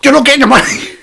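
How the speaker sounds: loud, high-pitched mock outburst; retold yell at a nagging friend; exasperated shout. Fed-up friend silencing talk about student loans, yelled.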